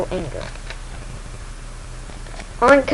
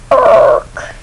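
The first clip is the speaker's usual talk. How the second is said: loud throaty mock beast groan; creature death groan; 8 dB louder than usual. A narrator's reading. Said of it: "the creature says".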